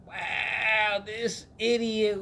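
reacting to the lovesick friend, scoffing; mock disbelief. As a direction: drag it out, amazed at the fool. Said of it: "And I'm like".

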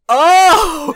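a loud, high "Oh!" of sudden realization; the penny drops all at once, out loud. Retold or imitated. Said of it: "And he's like".

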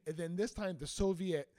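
ordinary talk, nothing marked

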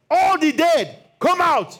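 loud, high summons in preaching voice; commanding shout. Retold or imitated. Preacher imagines Jesus calling every corpse, commanding shout. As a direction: cry out as if summoning a whole cemetery. Hypothetical call to all the dead.

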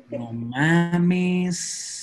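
casual chilango "no mames"; Mexican-style no mames. Shown as a demonstration. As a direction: drop "no mames" like a Mexico local. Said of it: "se me sale".

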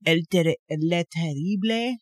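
a clumsy stab at French, lightly voiced; bad French accent. Shown as a demonstration. Speaker admits she can't do French, then tries a bad accent. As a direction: fumble a French accent, half-hearted. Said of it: "'Cause I currently cannot do this".

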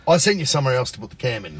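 ordinary delivery

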